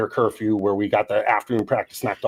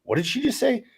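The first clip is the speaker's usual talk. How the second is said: shocked disbelief; 12 semitones higher, 4 dB quieter than usual. Retold or imitated. Retold shocked reaction to swearing.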